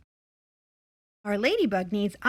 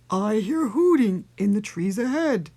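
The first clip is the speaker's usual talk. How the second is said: loud storybook monster voice; Gruffalo voice; 5 dB louder than usual. A narrator's reading. Gruffalo hears something ahead, loud monster voice. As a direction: announce it as a big beast. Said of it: "till the Gruffalo said".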